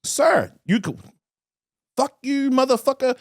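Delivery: high-pitched imitation cursing someone out; mock insult. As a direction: squeal the cussing like the other guy. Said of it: "Just like you".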